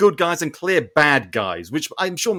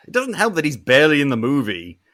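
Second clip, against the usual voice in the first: normal talking voice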